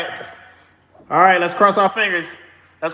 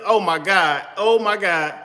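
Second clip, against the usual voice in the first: usual speaking voice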